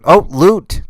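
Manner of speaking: excited. Real, not acted. Excited pick of loot.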